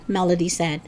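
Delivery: ordinary delivery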